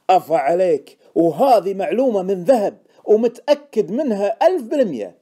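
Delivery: loud, high, emphatic vouching; stung insistence. A narrator's reading. Informant vouching for his golden tip, excited insistence. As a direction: vouch for it with full force.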